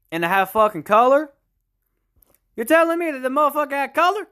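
high-pitched incredulous questioning; mock disbelief. Host marvelling at a colour handheld, in mock disbelief. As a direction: play up astonishment, pitch climbing as you ask.

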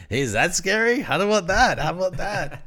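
high, loud mocking taunt; teasing friend. Friend shoving salsa face at him, high mocking taunt. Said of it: "starts putting it in my face like".

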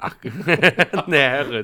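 laughing. Real, not acted. Teasing about the word Herrin.

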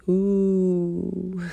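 hammy spooky Halloween "ooh"; a playful attempt at a ghostly moan. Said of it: "That was my attempt at a spooky voice".

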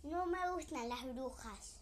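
a touch of angry refusal; angry crow. Acted. Crow angrily refuses the witch, mildly voiced. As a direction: snap back at the witch. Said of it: "muy enojado".

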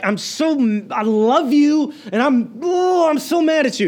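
high, loud, anguished pleading voice; loving yet angry. Retold or imitated. Pastor voicing a grieving parent to a wayward child, high anguished cry. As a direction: torn between love and anger, voice cracking high. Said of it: "This is an anger like".

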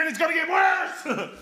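high emphatic warning; preaching shout. Real, not acted. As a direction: cry it out to the congregation.

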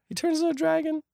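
extremely high, helpless squeal; squeaky disbelief. Real, not acted. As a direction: voice stuck at the top in bafflement. Baffled repeat of absurd twist.

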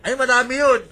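high, loud exclamation; loud boasting. Real, not acted. Host boasting to caller about Facebook friends, loud and high.

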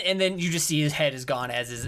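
normal talking voice

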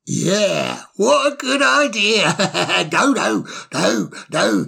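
put-on slurring, giggly drunk voice; tipsy bird, high and mocking; town drunk. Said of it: "in a drunken fashion".

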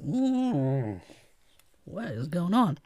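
slow, sleepy yawn through the words. Real, not acted. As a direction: let a yawn pull the words out slowly. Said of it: "There I go, yawning again".

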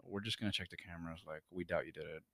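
calm bored cop voice; low, quiet cops. Retold or imitated. Valet imitating police calmly clearing him, low and soft.

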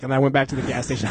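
normal talking voice

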